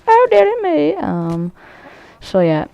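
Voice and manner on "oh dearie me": very high, loud falsetto; mock dismay. Gamer failing with predator missile, mock falsetto dismay. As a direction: squeal it in hammed-up dismay.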